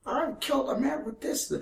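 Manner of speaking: high, soft gravelly character voice; Ratatouille old man impression. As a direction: quote it in a thin squeaky voice. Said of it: "that one guy from Ratatouille".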